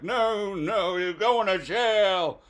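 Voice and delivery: hammed-up policeman's verdict; stern police. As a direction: shut him down, drawing out the sentence. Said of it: "And they're like".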